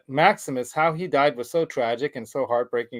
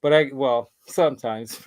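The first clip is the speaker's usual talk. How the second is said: ordinary talk, nothing marked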